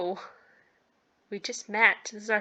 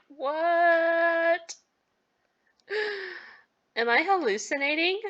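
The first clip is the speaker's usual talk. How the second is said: high-pitched, gasping disbelief; shocked disbelief; 9 semitones higher, slower than usual. Acted. Celine after everything goes red and blurry, high shocked disbelief. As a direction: act it stunned, high, with a gasp. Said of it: "You're like".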